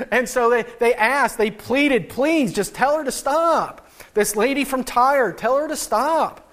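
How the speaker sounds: mock-beg Jesus to send her away; whiny disciples. Disciples pleading with Jesus about the woman, mock whiny. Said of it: "They said".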